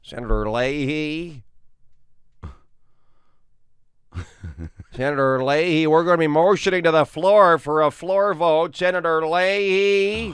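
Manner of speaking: slow, drawn-out senator call; Chuck Grassley. Retold or imitated. Senator calling Senator Leahy for a floor vote, slow drawn-out. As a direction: call the senator slowly across the chamber. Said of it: "Chuck Grassley".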